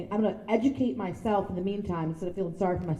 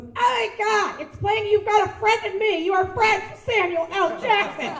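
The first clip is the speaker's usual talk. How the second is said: high, loud star-struck exclamation; amazed outburst; 11 semitones higher, 5 dB louder than usual. Retold or imitated. Friend amazed at Samuel L. Jackson's gift, high excited outburst. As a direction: gush in high-pitched amazement. Acts out Monica's amazed reaction.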